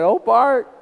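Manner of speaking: high, loud mock aunt's wail; Aunt Rose. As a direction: imitate a mortified aunt calling out. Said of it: "My Aunt Rose said".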